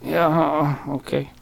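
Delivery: very soft, hesitant little reply; uneasy customer. Retold or imitated. Customer accepts an offer of help, quiet and uneasy. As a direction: imitate a wary customer, barely voiced.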